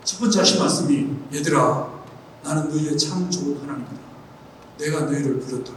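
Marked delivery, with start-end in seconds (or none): 1.3-5.7 soft, low and hushed, as God speaking gently; God's faint voice retold to the congregation, hushed and low; still small voice. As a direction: speak it barely above a breath, tender and low. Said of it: "아주 세미한 음성으로".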